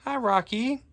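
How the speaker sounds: very high sing-song hello to a bird; cooing pet voice. Real, not acted. Greeting Rocky the parrot again in a high cooing pet voice.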